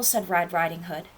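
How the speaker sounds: ordinary delivery, nothing marked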